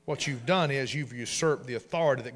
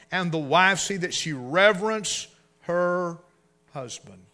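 raised, preacherly recitation; scripture reading; 5 semitones higher, 6 dB louder than usual. Acted. Preacher reads Ephesians aloud to the church. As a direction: proclaim the verse to the congregation.

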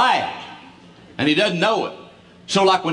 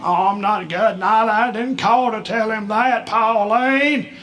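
put-on high, slow modest old man; dad's bashful refusal; 5 semitones higher, slower than usual. Dad telling his wife Pauline he isn't bragging. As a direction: play humble but proud.

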